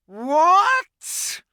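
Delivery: very high, very loud roar. Acted. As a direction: explode with rage. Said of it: "roared the witch".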